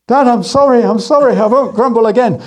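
put-on child dangled over the river begging his dad, pleading. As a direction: beg Dad to stop, a little panicked.

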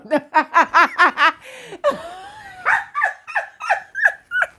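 long high squeal of laughter. Real, not acted. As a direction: let out a shrieking laugh. Laughing at her own joke.

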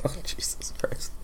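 breathless whispered exclamation. Real, not acted. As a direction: whisper it in mock dread. Startled reaction to the tune.

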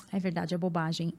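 soft, low, mock-resigned concession; meek agreement. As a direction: give in quietly, acting out your old self. Acts out giving in to others. (concordância resignada, em voz baixa)

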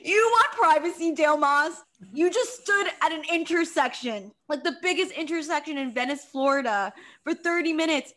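loud, high mocking outrage; mock Dale with loud scorn; mocking sarcasm.

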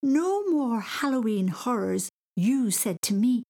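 high, drawn-out scandalised echo; slow, high, incredulous child; indignant quoting. Acted. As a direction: throw the words back in outrage. Boy indignantly throws words back.

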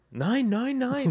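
high squeaky German "nein, nein, nein"; mock German accent. Joking with the host, mock German accent. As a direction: yelp out a jokey German "nine, nine, nine". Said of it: "That's my German accent".

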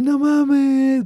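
a hint of a squeaky put-on girl voice; mild mock cutesy voice; faked little voice. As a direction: lightly raise it into a fake cute voice. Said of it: "finge la voz".

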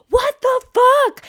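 high, loud, drawn-out yell; mock furious high-pitched shout; imitated outraged shriek. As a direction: shriek it slowly, like an outraged bystander. Acts outraged girl hit by vomit.

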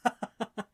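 disbelieving character laugh. A narrator's reading. Friend laughs at the marriage news, laughing. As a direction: laugh it off as a joke. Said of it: "began to laugh".

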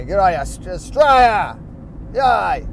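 loud cheer for Australia; jokey patriotic hooray; mock cheer. Real, not acted. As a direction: cheer them on, shouting. Cheering his country.

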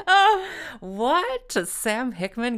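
high, loud squeal of fake astonishment; a hammed-up gasp of disbelief; mock surprise. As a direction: act stunned at your own news. Mock astonishment at own gig.

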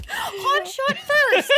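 high, loud, drawn-out cry through laughter; laughing outburst. Real, not acted. Joking that Archie hit the bear first, laughing outburst. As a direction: crow it out while cracking up.

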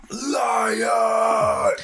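high, stretched-out accusing cry; drawn-out mock shout. Wishing he had called out the roommate's lie, drawn-out mock shout. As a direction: wail the accusation loudly.

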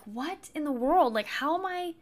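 confused what-is-happening exclamation; surprised. Retold or imitated. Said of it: "I remember being like".

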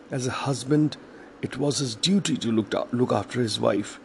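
ordinary delivery, nothing marked

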